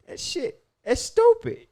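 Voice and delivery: high, loud exasperated burst; exasperated outburst. Real, not acted. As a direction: blurt it out in disbelief. Exasperated about buying property.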